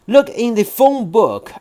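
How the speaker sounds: put-on a child talking back; boy voice.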